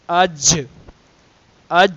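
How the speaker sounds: اج with the jeem held shut, sound stopped dead. Shown as a demonstration. Tajweed teacher to students, اج cut off with a hard stop. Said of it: "قوت کے ساتھ ٹھہر جائے گی".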